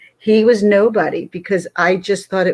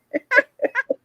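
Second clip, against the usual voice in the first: light high giggle; high laugh. Real, not acted. Finishing the boat show tale, a high giggle. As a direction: giggle at your own story. Ending her story.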